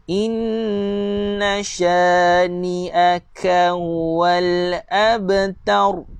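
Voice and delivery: very slow, drawn-out chant; measured tajwid recitation; Quran recitation. Shown as a demonstration. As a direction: recite it slowly, stretching each vowel.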